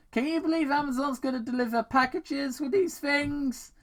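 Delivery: high, quick wisecrack; joking hero voice. Acted. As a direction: crack a joke mid-fight. Sonic's quip while riding drone.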